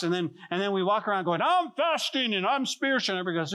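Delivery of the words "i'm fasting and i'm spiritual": high, showy mock-pious voice; mock boasting. Pastor mimicking believers showing off their fast, mock boasting. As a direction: parade your holiness in a raised, singsong boast.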